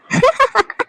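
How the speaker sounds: high, gloating giggle to herself; a villain's secret delighted laugh; gleeful laugh. Acted. Schemer laughs alone that nobody found out, gleeful giggle.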